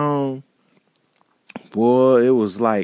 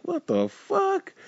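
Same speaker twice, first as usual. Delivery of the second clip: much higher, breathy-soft startled what the fuck; stunned disbelief; 6 dB quieter, slower than usual. Retold or imitated. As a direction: hit it like you can't believe your ears. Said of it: "I'm like".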